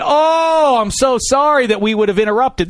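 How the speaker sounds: high, loud mock-sorry gushing; sarcastic apology. To the rude diner, gushing fake apology. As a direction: overdo the apology with a sneer.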